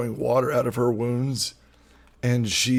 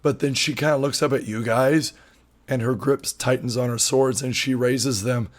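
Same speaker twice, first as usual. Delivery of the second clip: usual speaking voice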